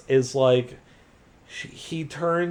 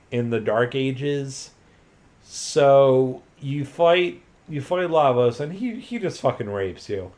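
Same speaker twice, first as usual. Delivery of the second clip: ordinary talk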